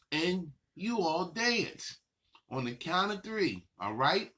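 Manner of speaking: ordinary talk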